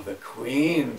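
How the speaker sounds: plain reading voice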